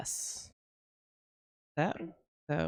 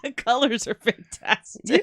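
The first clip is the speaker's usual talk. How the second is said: slow, loud laughing praise; 8 semitones higher, 6 dB louder, slower than usual. Real, not acted. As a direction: laugh while admiring. Laughing at the effect.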